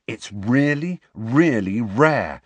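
slow, drawn-out proud boast; beaming with pride. A narrator's reading. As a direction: savour every word, proudly. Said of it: "said Neville, beaming".

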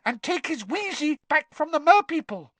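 high, squeaky house elf. Acted. Dobby speaking.

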